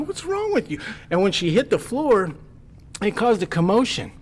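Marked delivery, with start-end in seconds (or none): 0.0-0.8 high-pitched disbelieving cry; incredulous yell. Retold or imitated. Naked man yelling at the woman on the floor. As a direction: throw it at her in disbelief.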